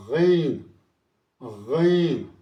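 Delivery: غین from the throat, loud and thick; heavy ghain. Shown as a demonstration. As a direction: growl out a full غین. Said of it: "پھر ایک موٹا حرف ہے". (بھاری غین، حلق سے)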